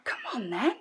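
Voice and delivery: whispered encouragement; hushed voiceless coaxing; raspy whisper. Real, not acted. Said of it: "I'm losing my voice".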